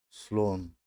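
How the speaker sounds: سلون said as Russian "slon" in one clipped syllable; سلون with a clear falling tone; Russian word. Shown as a demonstration. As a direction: model سلون crisply for the class. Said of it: "مثل دوستان".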